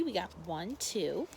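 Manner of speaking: ordinary delivery, nothing marked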